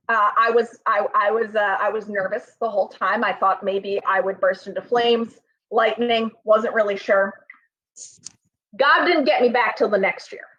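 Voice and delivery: usual speaking voice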